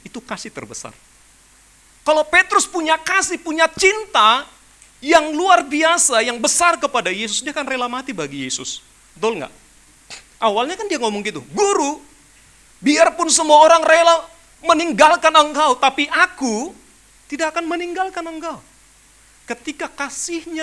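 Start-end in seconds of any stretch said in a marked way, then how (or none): 2.1-6.2 slow, louder stress on each phrase; drawn-out emphasis. Real, not acted. Preacher pressing the congregation, slow and emphatic. 11.5-18.6 louder, higher mock pledge of loyalty; Peter's bold vow. Peter swearing loyalty to his teacher, loud and proud.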